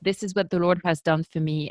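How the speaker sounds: ordinary talk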